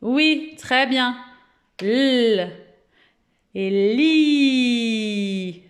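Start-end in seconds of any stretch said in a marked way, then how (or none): 1.8-2.5 L held long as "lll", louder; exaggerated letter sound. Shown as a demonstration. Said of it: "on exagère un petit peu quand on prononce". 3.9-5.6 "li" with a very long i; smiled ending. Shown as a demonstration. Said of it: "Je souris à la fin".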